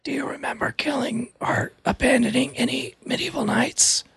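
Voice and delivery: breathy, high stage whisper. Acted. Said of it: "stage whisper".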